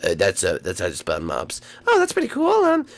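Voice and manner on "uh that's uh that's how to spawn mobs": deep villain voice. Acted. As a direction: growl it out like a game boss. Villain explains item.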